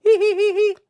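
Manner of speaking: very high, loud squeaky hee-hee giggle; a squeaky cartoon titter. Failed attempt at a viewer's gloating laugh, squeaky high giggle. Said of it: "I can't do it".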